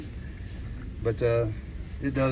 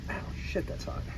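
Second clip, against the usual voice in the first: pained hiss of a burn; unvoiced yelp after touching something hot; burnt fingertips; 12 dB quieter than usual. Real, not acted. Burns fingers on hot part.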